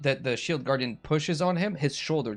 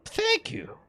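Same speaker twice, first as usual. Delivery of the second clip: very high, put-on wizard voice; villain's voice. Slimy wizard thanking the man who insulted him, high-voiced. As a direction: answer in a tiny high character voice.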